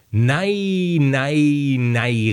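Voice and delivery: crying out. A narrator's reading. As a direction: stretch each word out like a protesting call. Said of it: "rief der Zwerg". (langgezogen gerufen)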